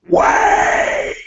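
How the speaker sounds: loud, drawn-out rasping shout; a hoarse shout from behind; shouted call. Acted. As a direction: call out "wait" in a long voiceless roar. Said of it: "you hear a voice go".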